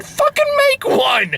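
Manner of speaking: high-pitched exasperated shout; mock-outraged squawk; exasperated outburst. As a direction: yell it at them, voice leaping way up. Mock outburst at the film's characters.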